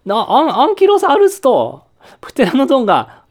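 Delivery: loud, high, amazed cry; excited shout. Pretend. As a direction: shout out in wonder. Surprised kids see playing dinosaurs.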